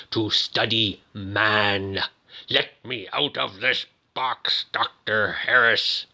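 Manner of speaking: slow, cold machine voice; eerie computer voice. Retold or imitated. Recapper voicing the AI asking its doctor for release, slow and cold. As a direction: speak like a calculating AI, slowly. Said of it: "He says".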